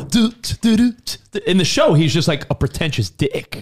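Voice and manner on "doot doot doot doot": high-pitched goofy doot-doots; sing-song it like a dorky nerd. Retold or imitated. Mimicking goofy nerdy Carlton in the TV show, high and silly. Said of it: "the goofy, nerdy, like".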